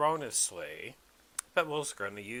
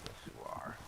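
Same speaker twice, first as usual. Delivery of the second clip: whispering; 13 dB quieter, faster than usual. Real, not acted. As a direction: breathe it to the dog. Cooing to the dog.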